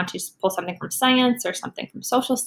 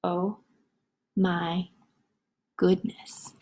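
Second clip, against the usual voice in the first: exhausted whisper; 3 semitones lower, 6 dB quieter, much slower than usual. Acted. Reader exhausted after the silly sounds.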